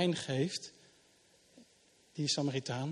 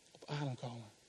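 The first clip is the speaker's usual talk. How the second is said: much softer, sinking low on adem; soft and gentle; 5 semitones lower, 12 dB quieter than usual. Acted. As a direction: let it settle quietly like a breath. Gentle invitation to rest. (zacht en rustig, "adem" laag uitgesproken)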